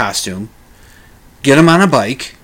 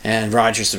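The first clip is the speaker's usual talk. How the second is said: normal talking voice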